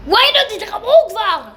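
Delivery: high, loud exasperated yell; impatient yell. Real, not acted. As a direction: yell at them to hurry up. Impatient at players joining. (צעקה חסרת סבלנות, גבוהה ורמה)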